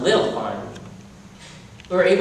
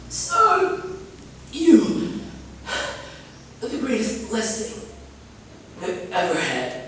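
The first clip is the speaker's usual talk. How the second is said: dying mother's voice; 3 semitones higher, 6 dB quieter than usual. Acted. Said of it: "my mama ... said".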